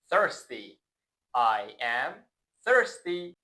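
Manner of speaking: ordinary delivery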